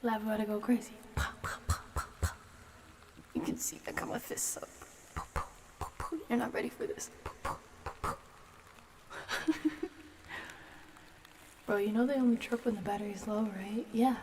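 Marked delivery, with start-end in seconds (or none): 1.1-8.1 low growled punch sounds, pa pa pa; punch noises. Pretend. Fists up, playing a fighter: low punch sounds. 9.1-10.0 airy laugh rising up; high breathless laugh. Real, not acted. After the mock fight with a friend: airy laugh.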